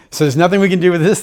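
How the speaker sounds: high, loud, fast question; acted-out incredulous question; retold disbelief. Questions doctors about hopeless prognosis.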